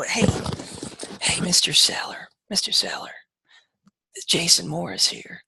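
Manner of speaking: whispering nervously into the phone; a shy agent who whispers the pitch; timid whisper. Retold or imitated. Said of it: "they whisper".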